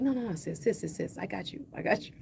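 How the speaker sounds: soft, quiet, kind reassurance; reassuring stranger. Retold or imitated. Kind woman in line reassuring her, softly. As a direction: hush it gently, like easing a sister's worry. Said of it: "She was just like".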